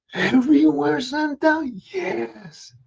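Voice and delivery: high rising wonder; amazed. Acted. Ricky amazed, asking Santa. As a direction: ask it wide-eyed.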